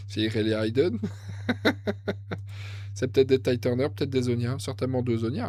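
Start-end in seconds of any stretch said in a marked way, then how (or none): 1.0-2.9 rire sans voix, tout en souffle; rire soufflé. Real, not acted. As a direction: ris presque en silence, dans ton souffle. Rit de l'Aiden. (rire étouffé soufflé)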